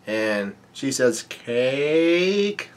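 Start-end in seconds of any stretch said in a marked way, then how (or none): 1.2-2.7 one long word sliding steadily upward; drawn-out craving. Acted. Reader voicing a cake-craving character, drawn-out rising call. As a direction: stretch it out, pitch climbing to the end.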